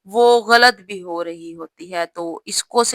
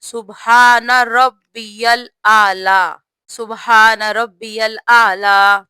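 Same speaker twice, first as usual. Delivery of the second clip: very slow, each word of سبحان ربی الاعلی drawn out; slow careful recitation; 5 dB louder, much slower than usual. Shown as a demonstration. Teacher reciting the sajda tasbeeh slowly. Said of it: "سجدے میں جو تسبیح پڑھی جاتی ہے".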